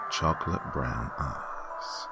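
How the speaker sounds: ordinary delivery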